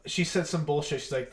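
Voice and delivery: ordinary talk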